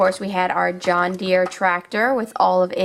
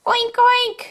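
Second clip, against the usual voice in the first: high, squeaky little oinks; piggy oink. Pretend. Runaway toy pig taunting, high squeaky oinks. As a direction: oink like a cartoon piglet.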